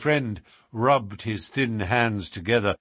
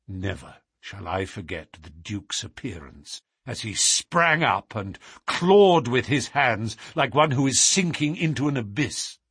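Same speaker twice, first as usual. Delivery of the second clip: ordinary delivery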